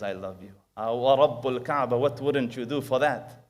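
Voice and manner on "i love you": soft loving words. Retold or imitated. The Rasul says I love you.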